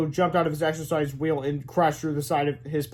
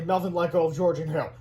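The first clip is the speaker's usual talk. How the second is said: ordinary delivery, nothing marked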